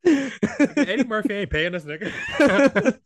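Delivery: high, loud shout breaking into laughter; shouting through laughter about not being paid; laughing shout. Real, not acted. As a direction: yell it while cracking up. Joking about McDonald's sponsorship.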